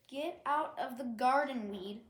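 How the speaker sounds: loud yell, falling hard on out. Acted. Lily yells at Dandy to leave the garden, loud. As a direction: bark the line at her, loud. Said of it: "she yelled".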